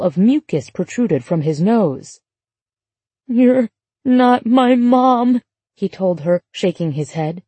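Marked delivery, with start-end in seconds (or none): 3.3-5.4 put-on slow, drawn-out accusing child voice, pitched up; petulant, accusatory drawl, higher than usual; petulant little boy. A narrator's reading. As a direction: drag it out like a sulky, accusing small boy. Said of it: "There was a petulant, accusatory edge to his tone".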